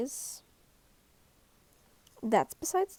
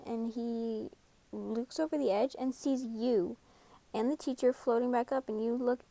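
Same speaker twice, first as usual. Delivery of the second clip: plain reading voice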